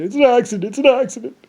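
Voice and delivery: hammed-up frantic pilot after ejecting; panicked pilot. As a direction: squeal it out like a caught pilot. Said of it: "he said".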